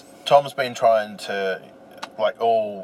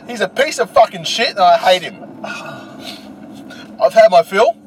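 loud, raised-pitch hammed-up outburst; mock angry rant; 8 semitones higher, 5 dB louder than usual. Co-host gets "real" about Alan on the podcast, mock angry rant. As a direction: pretend you have utterly had it. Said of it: "get a little bit real now".

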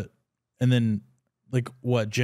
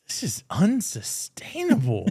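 slow, higher, drawn-out verdict; dramatic declaration; 6 semitones higher than usual. Retold or imitated. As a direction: deliver it like a grand realization. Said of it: "I was like".

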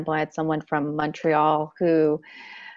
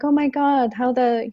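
put-on French accent; 6 semitones higher, 4 dB louder than usual. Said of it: "she has this beautiful French, French accent".

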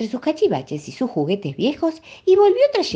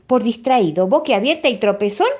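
higher, louder scolding; angry mother; 6 semitones higher, 4 dB louder than usual. A narrator's reading. Angry mother scolds Felipito over broken eggs. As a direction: scold the clumsy boy. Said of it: "muy enojada".

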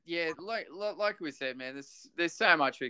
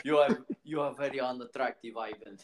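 put-on sketch-show Russian accent; mock Russian accent; 4 dB quieter than usual. Imitating a TV sketch character, mock Russian accent. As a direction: do a deadpan comic Russian accent. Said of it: "Like, uh, old mate from Fast Forward".

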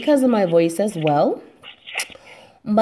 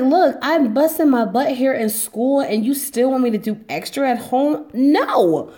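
exasperated student; 6 semitones higher, 4 dB louder than usual. Retold or imitated. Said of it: "I'm just like".

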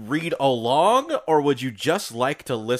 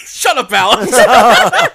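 laughing; 8 semitones higher, 10 dB louder, much slower than usual. Real, not acted. Laughing at a joke.

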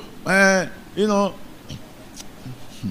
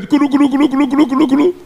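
higher, quick mock ritual chanting; 7 semitones higher, much faster than usual. Preacher to church, mocking a shrine ritual with a higher mock chant. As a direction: rattle off a nonsense chant.

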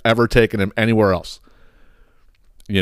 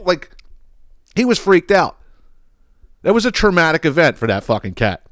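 normal talking voice

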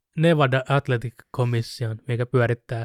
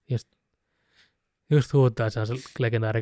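ordinary delivery

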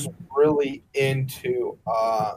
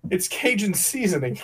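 ordinary talk

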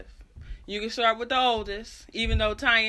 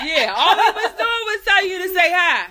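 high, loud laughing; laughing while teasing; 9 semitones higher, 9 dB louder than usual. Real, not acted. Laughing at mom.

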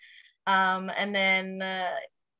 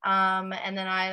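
normal talking voice

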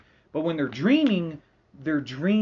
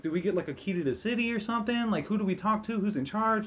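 higher, fast eager questions; hopeful astronauts; 6 semitones higher than usual. Retold or imitated. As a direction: ask eagerly for the VIP treatment. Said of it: "they're just like".

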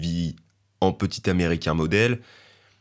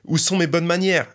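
cheery greeting; 9 semitones higher than usual. Acted.

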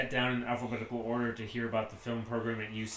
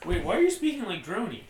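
high, louder confused outburst; startled high protest; baffled; 8 semitones higher, 6 dB louder, faster than usual. Acted. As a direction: thrown completely off, demanding to know. Baffled by robot voice.

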